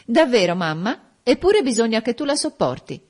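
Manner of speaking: high, loud imperious daughter. A narrator's reading. Bianca overrules her mother, imperious. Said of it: "con la sua voce imperiosa".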